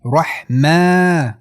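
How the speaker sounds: 'رحمه' with long held maa; drawn-out madd. Shown as a demonstration. Teacher shows natural madd, vowel held. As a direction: stretch the second syllable of 'رحمه'.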